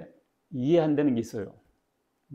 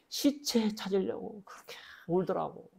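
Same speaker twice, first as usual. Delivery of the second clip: whispered, higher; hushed; 6 semitones higher, 7 dB quieter than usual. Real, not acted. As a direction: breathe it out quietly in grief. Grieving Sewol parents retold.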